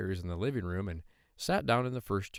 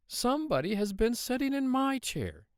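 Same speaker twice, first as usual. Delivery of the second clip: high middle-sized bear voice; storybook mother bear, high and moderate in volume; Mama Bear. A narrator's reading. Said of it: "said the Mama Bear in her middle-sized voice".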